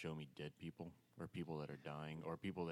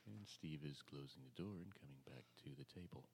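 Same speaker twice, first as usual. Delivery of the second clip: golf commentator; 2 semitones lower, 7 dB quieter than usual. Acted. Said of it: "I start talking like a golf commentator".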